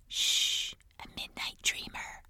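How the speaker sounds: hushed whisper, barely voiced. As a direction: whisper so you don't wake him. Hushing over a sleeping lemur.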